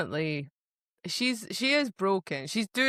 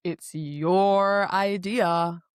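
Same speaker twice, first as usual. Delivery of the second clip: very drawn-out, louder mock blame; mock gaslighting; 5 dB louder, much slower than usual. Imitating him gaslighting her, drawn-out mock blame. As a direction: stretch it out, laying the blame on the listener. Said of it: "let me just gaslight you some".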